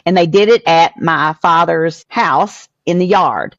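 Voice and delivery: normal talking voice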